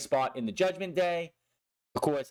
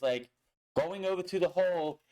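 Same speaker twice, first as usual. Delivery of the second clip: ordinary talk, nothing marked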